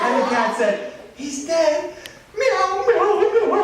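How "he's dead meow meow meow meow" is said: high, wailing meows; hammed-up weeping kitty; crying cat. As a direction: meow as if bawling, high and theatrical. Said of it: "Cat, why are you crying?".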